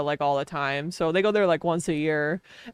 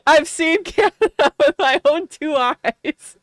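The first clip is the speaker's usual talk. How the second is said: laughing; 6 semitones higher, 3 dB louder, slower than usual. Real, not acted. Laughing at own story.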